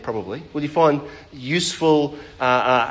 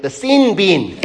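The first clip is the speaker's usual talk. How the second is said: sin bin in a broad Australian accent, higher and louder; 5 semitones higher, 6 dB louder, slower than usual. Shown as a demonstration. Preacher mocking Australians for the congregation. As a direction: put on an Aussie twang for sin bin. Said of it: "let me put my Australian accent on".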